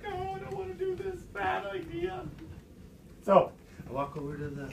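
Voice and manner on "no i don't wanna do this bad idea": very high, softer little whine; reluctant inner voice. Retold or imitated. Storyteller's inner voice dreads the hole, high squeak. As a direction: squeak out a secret mock-scared protest. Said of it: "But inside I'm like".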